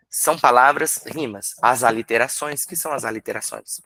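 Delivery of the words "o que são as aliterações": normal talking voice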